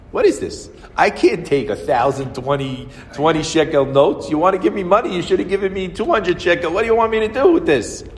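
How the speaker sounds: high, loud exasperated complaint; retold indignant protest. Cashier refusing the customer's cash, high indignant protest. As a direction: act out an outraged cashier objecting.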